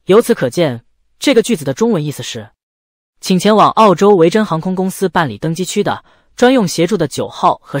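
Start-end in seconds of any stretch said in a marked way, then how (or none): none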